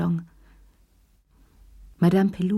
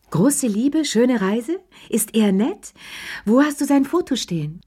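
eager prying; 6 semitones higher than usual. A narrator's reading. Madame Pelou pressing Lea for details, eagerly prying and higher. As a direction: pounce on her with gossip-hungry questions.

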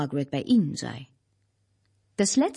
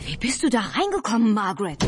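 agitated, higher-pitched calling out; flustered, raised-pitch question; 4 semitones higher than usual. A narrator's reading. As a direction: call out, all worked up. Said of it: "rief Albert völlig aufgeregt".

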